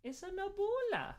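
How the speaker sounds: high sing-song pet talk. Real, not acted. Cooing over her cat.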